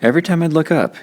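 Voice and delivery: ordinary delivery, nothing marked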